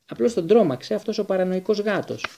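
annoyed. Acted. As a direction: snap at the cat's expense.

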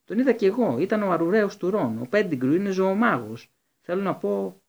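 high loud rush of words; urgent insistence. A narrator's reading. Hermione insisting to the Minister, high and loud. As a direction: press the point breathlessly.

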